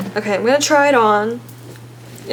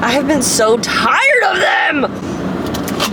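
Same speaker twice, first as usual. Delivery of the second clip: exasperated; 4 semitones higher, much slower than usual. Real, not acted. Fed up with her bangs.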